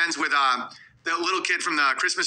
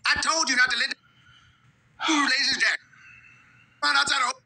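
put-on old-timey scolding; 8 semitones higher than usual. Scolding voiced character.

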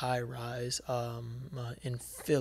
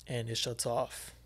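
ordinary delivery

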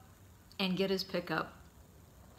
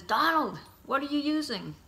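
louder, higher call to someone far off; calling across a river; 9 semitones higher, 7 dB louder than usual. Retold or imitated. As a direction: shout the question over the water. Dad calls across the river.

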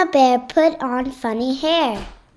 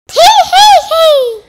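witch cackle; 7 dB louder than usual. Acted. Hidden witch giggles.

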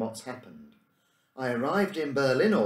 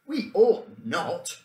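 slow, firm and louder, stress on "not"; sharply; 8 semitones higher, 7 dB louder, much slower than usual. Acted. The Professor refusing the boys a gun, slow sharp rebuke. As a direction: cut them off, slow and sharp. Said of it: "returned the Professor sharply".

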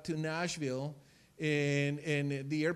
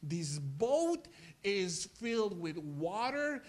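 ordinary talk, nothing marked

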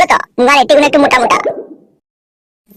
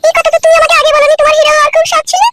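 much higher female voice, admiring; wife character. Acted. Wife praising husband's new shirt, high female voice. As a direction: play the doting wife, high-pitched.

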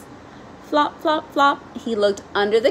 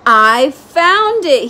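louder, slow, drawn-out shout of discovery; triumphant yell; 4 semitones higher, 8 dB louder, slower than usual. A narrator's reading. Froggy finding his lost butterfly net, triumphant yell. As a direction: shout it like you just struck treasure. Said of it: "he yelled".

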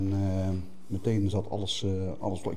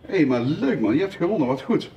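excited; 7 semitones higher, 7 dB louder than usual. Real, not acted. Cheering a child's win.

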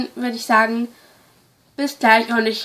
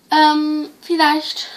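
ordinary talk, nothing marked

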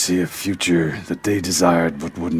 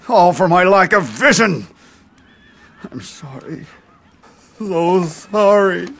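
grief; 10 semitones higher, 5 dB louder than usual. Acted. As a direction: mourn the fallen men. Lord grieves lost men.

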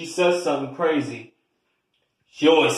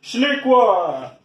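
high, drawn-out call across the house; 10 semitones higher, 5 dB louder, slower than usual. Acted. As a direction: yell her name to fetch her. Calling her from downstairs.

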